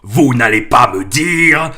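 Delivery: slow, loud furious roar. A narrator's reading. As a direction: roar it at the Dursleys. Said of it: "rugit Hagrid".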